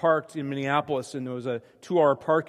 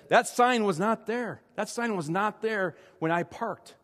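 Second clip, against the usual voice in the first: exasperated protest; 6 semitones higher than usual. Retold or imitated. Arguing with tow truck driver.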